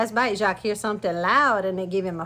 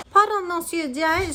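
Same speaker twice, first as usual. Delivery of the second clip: high, slow teasing politeness; mock the giant sweetly; cheeky smile; 7 semitones higher than usual. A narrator's reading. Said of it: "Jacques say with a smile".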